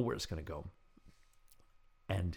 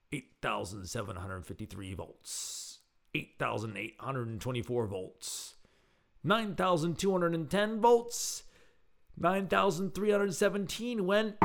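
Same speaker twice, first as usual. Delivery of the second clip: mounting suspense; 6 semitones higher, slower than usual. A narrator's reading. Narrator reading out a climbing voltmeter, with mounting suspense. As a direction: count upward with growing tension.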